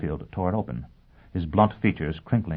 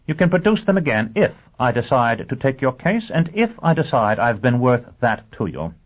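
higher, emphatic lawyer's voice; brisk lawyer, assertive; 7 semitones higher, 6 dB louder than usual. Acted. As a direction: lay down terms to a client. Lawyer lays down terms.

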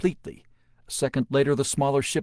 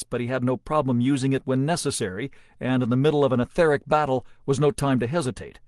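plain reading voice

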